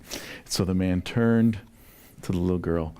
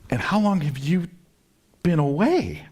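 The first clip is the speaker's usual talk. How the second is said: much higher and louder, eager; eager question; 9 semitones higher, 3 dB louder than usual. Retold or imitated. The stranger eagerly asks the husband, higher and louder. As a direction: push the question out, thrilled.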